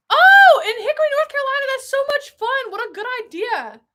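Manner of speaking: high, delighted burst after a loud oh; excited gushing. Real, not acted. Delighted by a viewer's fun plan, excited high gushing.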